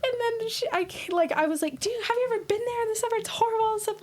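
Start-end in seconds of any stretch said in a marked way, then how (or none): 1.8-4.0 fast, much higher, exasperated arguing; heated retort. Retold or imitated. Teen arguing with her friend on the road trip, exasperated and fast.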